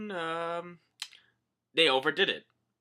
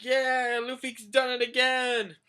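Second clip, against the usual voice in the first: high, loud mock cheer; cheering villagers; 12 semitones higher, 6 dB louder, slower than usual. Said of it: "villagers are cheering like".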